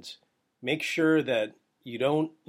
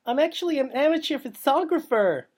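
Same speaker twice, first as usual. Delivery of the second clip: high, mocking, put-on voice; sing-song mock of a braggy amateur; mocking bridesmaid; 10 semitones higher, 3 dB louder than usual. Mimicking the bridesmaid photographer, high and mocking. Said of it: "She's like".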